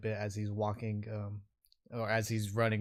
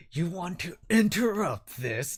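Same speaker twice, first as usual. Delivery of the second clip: slow, loud, raised threatening voice; menacing orc; 8 semitones higher, 5 dB louder than usual. Acted. Orc challenging the guard, slow loud menace. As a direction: challenge him slowly, stretching the words. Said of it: "he looks at the guard and he's like".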